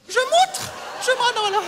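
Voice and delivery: high, loud indignation; outraged. Acted. Landlady scandalised by the agent's insult, outraged. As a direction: splutter with theatrical outrage. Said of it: "je suis outré".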